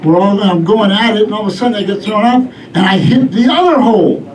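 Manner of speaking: ordinary talk, nothing marked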